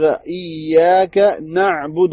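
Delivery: ایاک نعبد recited slowly with a clear ain; slow, with the ain sound in نعبد clear; careful recitation. Shown as a demonstration. Teacher reciting the verse correctly, slow and clear. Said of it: "عین کی صحیح سی آواز نکالنی ہے".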